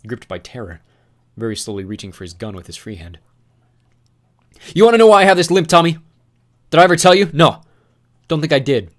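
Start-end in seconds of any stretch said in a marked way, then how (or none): 4.7-8.9 bitter taunt. Acted.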